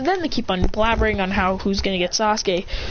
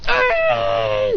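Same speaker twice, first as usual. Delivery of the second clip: high-pitched squeal; long high acted squeal; fangirl scream. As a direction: shriek at hearing his name. Girl squeals at team news.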